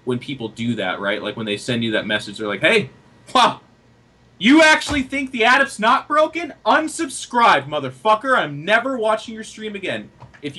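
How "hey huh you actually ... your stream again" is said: mock angry viewer. Viewer telling a streamer he quits watching, mock yell.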